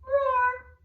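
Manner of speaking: high, loud mama bear roar. Shown as a demonstration. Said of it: "Give me a mama roar".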